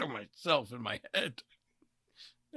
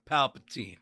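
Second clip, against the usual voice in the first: usual speaking voice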